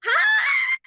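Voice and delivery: high, loud delighted squeal of hello; long, piercing excited greeting. Real, not acted. Excited guest greets host.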